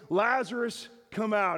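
high, commanding call; loud calling out. Acted. Jesus calling the dead man from the tomb, loud. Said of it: "he calls out in a loud voice".

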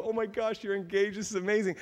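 high, gushing delight; excited congratulations. Acted. Speaker greeting a newly engaged couple, high-pitched excitement. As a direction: gush as if just hearing happy news.

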